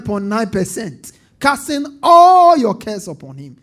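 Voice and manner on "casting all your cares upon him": loud rising preacher's cry on every "when"; preaching at full force. Acted. Pastor drives the crowd to cast all cares, full-force preaching. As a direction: build each "when you cast all" higher and louder.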